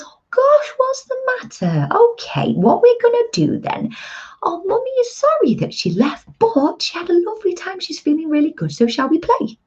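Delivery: high, sing-song soothing mum; soothing a toddler after coming home, high and sweet. Pretend. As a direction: coo at the little ones like a loving mum. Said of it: "that's my mum voice".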